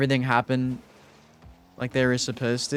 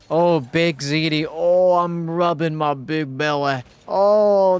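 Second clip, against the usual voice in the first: slow, higher goofy ogre voice; Shrek-like voice; 3 semitones higher, 5 dB louder, slower than usual. Pretend. Streamer voicing a viewer's name in a goofy ogre voice. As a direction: ham up a big-bellied ogre. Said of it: "imagine you saying it in that voice".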